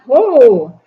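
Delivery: burst of laughter. Acted. King's first laugh bursts out.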